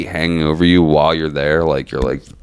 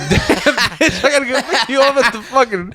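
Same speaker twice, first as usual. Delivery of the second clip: high, laughing grumble; giggling through a put-upon gripe; laughing mock complaint. Grandson laughing while retelling his stoned reaction to the call.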